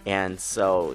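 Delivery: ordinary talk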